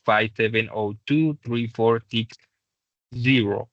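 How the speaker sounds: slow phone number, one digit at a time. Shown as a demonstration. Teacher showing how to give a phone number, digit by digit. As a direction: read each number out separately and slowly. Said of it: "es mejor decirlos uno por uno".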